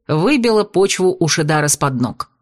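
usual speaking voice

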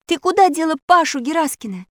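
high-pitched accusing question; loud demand. A narrator's reading. Alisa questions the unmasked Zanoza, loud demand. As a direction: demand an answer from the culprit. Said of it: "заявила она громким голосом".

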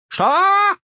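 sudden loud high squeal; shriek. A narrator's reading. Old woman reacts to being ordered out, shrieking. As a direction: explode in a piercing outraged shriek. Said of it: "взвизгнула вдруг Щукина".

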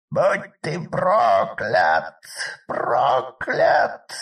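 shrieking witch curse, slow and loud; trapped animal. Acted. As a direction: scream the curse like a cornered beast. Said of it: "визжала запертая в ловушке колдунья".

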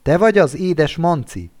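ordinary delivery

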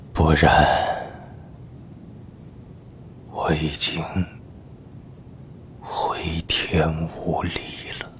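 faint, breathless whisper of a dying man; weak whisper. Acted. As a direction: barely get the words out. Dying old minister speaks weakly. (气若游丝的耳语)

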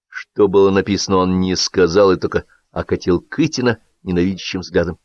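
ordinary delivery, nothing marked

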